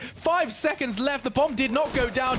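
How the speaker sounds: high call climbing to a peak; frantic play-by-play. Real, not acted. Caster counts down the final seconds, frantic. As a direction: build to a screaming-high climax.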